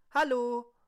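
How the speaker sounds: high, loud squeaky voice; high silly voice. Acted. Quiz show guest greeting.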